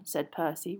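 ordinary delivery, nothing marked

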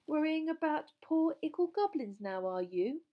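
loud, high mock baby-talk; teasing. A narrator's reading. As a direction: taunt with exaggerated pity. Ron teases Hermione about goblins.

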